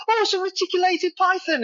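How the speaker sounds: put-on high, eager little British boy; imitating a high little British boy; British kid voice. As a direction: play a small British child naming the snake. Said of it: "he's a little British kid".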